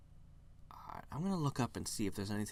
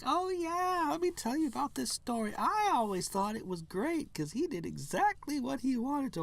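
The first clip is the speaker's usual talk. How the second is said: gushing friend. Retold or imitated. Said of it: "and she's just like".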